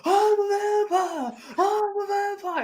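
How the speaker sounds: high, drawn-out crazed wail; mock scream. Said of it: "screaming down the street".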